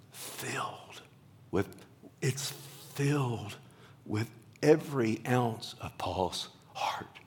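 slow, whispered with feeling; emotional hush. Real, not acted. Preacher overcome while explaining the word O, whispered. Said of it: "I get so emotional".

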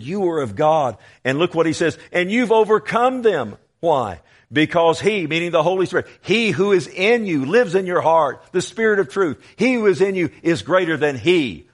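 high, loud, rousing pulpit delivery; full-force preaching. Acted. Preacher tells congregation they have overcome, rousing full-force preaching. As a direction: proclaim it with mounting force.

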